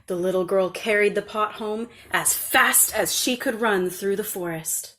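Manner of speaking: plain reading voice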